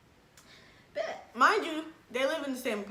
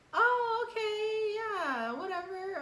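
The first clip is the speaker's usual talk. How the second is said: high sing-song "whatever", much higher; sing-song brush-off; 11 semitones higher, much slower than usual. Retold or imitated. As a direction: toss it off like you don't care. Mock sing-song brush-off reply.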